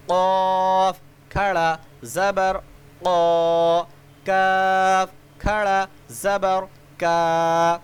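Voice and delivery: slow, drawn-out chanted letter recitation; sing-song spelling drill. Shown as a demonstration. Teacher spelling letters aloud for children, slow sing-song chant. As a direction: chant each letter and its vowel for young learners.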